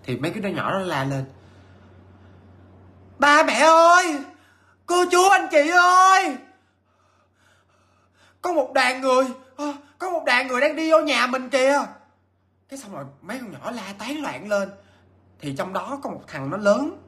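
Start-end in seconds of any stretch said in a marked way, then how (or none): 3.2-11.8 put-on much higher, loud child's cry for parents; frightened child calling out. Children shout to the adults about marching soldiers, high and loud. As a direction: yell like a scared kid spotting something. Kids shout ghosts approaching.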